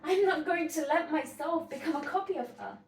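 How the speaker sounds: heightened stage-heroine finish; Sylvia, resolute then pleading. Acted. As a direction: refuse that fate, then appeal to a confidante. Sylvia resolves not to become her.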